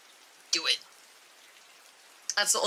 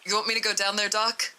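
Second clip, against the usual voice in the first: ordinary delivery, nothing marked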